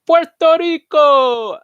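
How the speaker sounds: high, loud, drawn-out Spanish accent; hammed-up Spanish accent. Shown as a demonstration. Host answering a pronunciation question, hammed-up Spanish accent. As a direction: belt it out in an exaggerated Spanish accent. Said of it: "How do you pronounce Puerto Rico in Spanish dialect".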